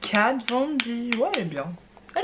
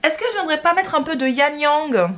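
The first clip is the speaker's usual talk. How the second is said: raised, sing-song pitch, louder; high mock question; 7 semitones higher, 7 dB louder than usual. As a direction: ask it in a high playful voice. Voix aiguë jouée, question au public. (voix aiguë et espiègle)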